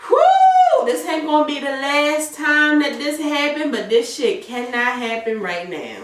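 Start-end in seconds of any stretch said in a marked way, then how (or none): 0.1-0.9 a sharp, high exhale of relief; hammed-up relieved whew; high loud whew. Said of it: "and it's just like".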